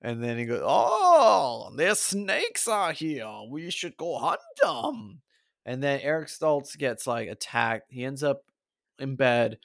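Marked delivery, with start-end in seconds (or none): 0.6-5.2 much higher, slow, hammed-up Latin American accent; terrible Latin American accent. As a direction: drawl it high in a bad Latin American accent. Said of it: "terrible accent on".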